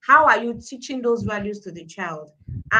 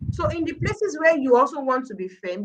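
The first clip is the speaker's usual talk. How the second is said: ordinary talk, nothing marked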